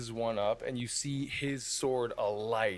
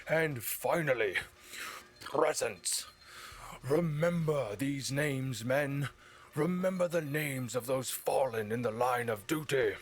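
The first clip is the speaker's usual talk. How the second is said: through a bullhorn; 3 semitones higher than usual. Acted. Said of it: "speaking through a bullhorn".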